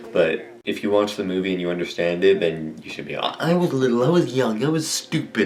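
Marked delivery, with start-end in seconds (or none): 3.1-5.5 defensive excuse. Pretend. Defending himself over early confusion.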